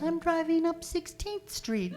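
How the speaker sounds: high, slow vacant midwife voice; oblivious midwife. Retold or imitated. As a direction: float it up high, mock unhurried.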